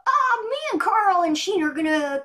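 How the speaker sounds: put-on high excited boy voice; loud, bright cartoon boy; Jimmy Neutron. Shown as a demonstration. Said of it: "can we hear a little bit of Jimmy?".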